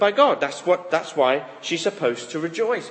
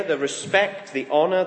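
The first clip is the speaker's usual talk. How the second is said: ordinary delivery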